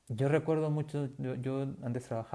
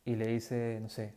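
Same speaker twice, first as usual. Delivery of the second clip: usual speaking voice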